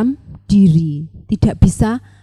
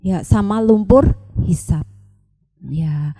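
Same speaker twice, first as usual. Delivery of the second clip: ordinary delivery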